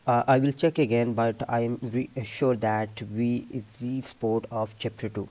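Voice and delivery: loud, slow repetition from memory; repeat-sentence demo. Shown as a demonstration. Teacher repeats what was played to show students, repeat-sentence demo. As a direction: repeat the heard sentence loudly, haltingly. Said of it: "दोबारा मैं ये repeat करता हूँ".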